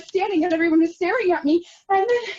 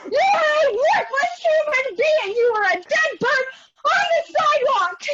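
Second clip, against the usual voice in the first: crying shout; 11 semitones higher than usual. Acted. Self-loathing outburst.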